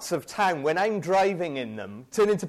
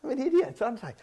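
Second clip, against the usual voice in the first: normal talking voice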